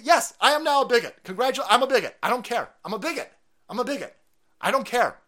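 high, emphatic defiance, loud at first; defiant outburst. Real, not acted. Defiant answer to being called bigot.

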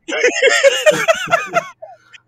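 loud, high-pitched real laugh; burst of laughter. Real, not acted. Cracking up at friend's yawn imitation, loud laughter.

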